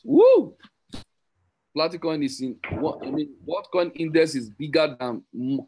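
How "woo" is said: excited whoop. Real, not acted. Cheering the coin's new listing.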